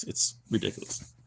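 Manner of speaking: a hushed aside to the viewers; whispered aside. Real, not acted. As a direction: whisper it under your breath. Muttered aside.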